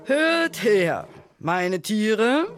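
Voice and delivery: high, loud summons to the crowd; high ringing proclamation; calling lion king. A narrator's reading. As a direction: call out grandly to all the animals. Lion king calls animals together.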